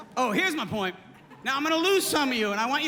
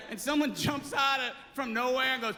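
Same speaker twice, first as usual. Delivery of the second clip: ordinary delivery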